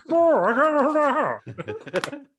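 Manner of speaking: very high, slow put-on voice; Cheeks voice. Stuck on the top rope, Cheeks calling out in a very high slow voice. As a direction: stretch it out way up high like a big helpless guy. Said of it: "'cause he's got, like, a fat voice".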